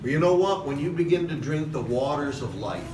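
ordinary delivery

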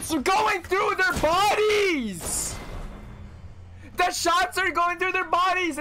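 indignant. Real, not acted. Shots not registering.

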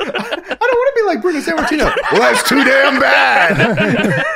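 high, laughing put-on voices; hammed-up father-son quarrel. Co-hosts acting out Russo arguing with his papa, laughing and high. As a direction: play both sides as a cartoon spat.

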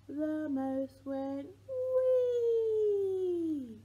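very slow, high, loud "wee" held out; lifting the baby on the rhyme's wee, excited; long wee. Pretend.

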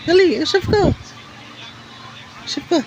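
high sing-song urging; high coaxing. Real, not acted. Urging baby to talk.

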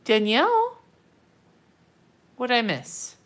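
usual speaking voice

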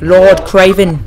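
usual speaking voice

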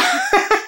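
short high giggle; high laugh. Real, not acted. Laughing at the episode.

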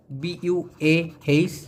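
letters spelled out slowly; slow spelling out. Shown as a demonstration. Teacher spelling বুয়াহ for learners, slowly. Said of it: "বুয়াহ".

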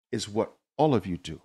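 normal talking voice

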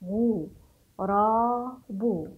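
"غو، را، بو" as separate long syllables; spelling out syllables. Shown as a demonstration. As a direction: sound out "غو، را، بو" deliberately. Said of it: "نقرأ ونتهجى الكلمات". (تهجئة "غو، را، بو" مقطعًا مقطعًا)

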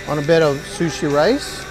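ordinary delivery

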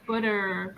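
"butter" slowed, with an American rolled r ending; American pronunciation. Shown as a demonstration. Teacher showing how Americans say it, American pronunciation. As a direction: draw out "butter" the American way, with a strong r. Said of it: "They, like, roll it".

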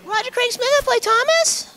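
Thomas character voice. Pretend. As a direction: drop into the Thomas cartoon voice. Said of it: "your character voice".

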